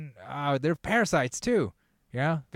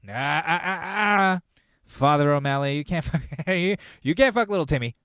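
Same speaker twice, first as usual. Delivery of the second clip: louder, higher scolding of the priest; mock Pope scolding; 3 semitones higher, 5 dB louder than usual. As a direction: shoo the priest off, loud and high. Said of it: "he's like".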